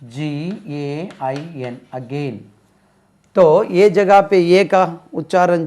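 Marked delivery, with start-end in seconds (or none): 0.0-2.4 slow letter by letter; very slow spelling for students. Shown as a demonstration. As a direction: spell it out slowly, then say the word. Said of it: "इसका spelling तो अब तुमको मालूम है".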